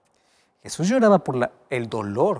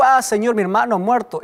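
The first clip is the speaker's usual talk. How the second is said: high, loud mocking take on Marta; hammed-up wrong version, pitched up high; mock wrong answer; 9 semitones higher, 5 dB louder than usual. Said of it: "No le dijo". (Marta exagerada, aguda y fuerte)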